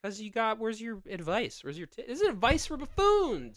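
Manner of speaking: high goofy buffoon whine. Acted. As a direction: play a silly buffoon. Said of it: "I'm being a buffoon".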